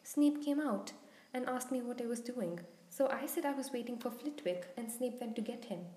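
ordinary delivery, nothing marked

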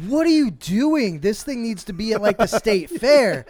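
retelling his shock at the huge pig; amazed outcry. Retold or imitated. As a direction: gawk at the thousand-pound pig.

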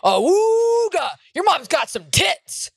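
high, loud cartoon ogling voice; hammed-up leering dad impression; cartoonish dad. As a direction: stretch the awooga out like a cartoon horn. Mimics dad ogling in cartoon style.

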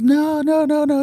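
imitating the girl. Girl denying she was working, imitated high. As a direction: pitch her string of noes way up. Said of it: "And she goes".

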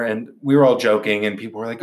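normal talking voice

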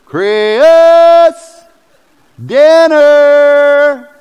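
long high shouted call, Chris stretched into two syllables; mom calling out. Retold or imitated. Mom yelling for her son to come in to dinner, drawn-out high shout. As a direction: belt out the name like a mom calling from the back door. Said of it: "turn my one-syllable name, Chris, into a two-syllable word".

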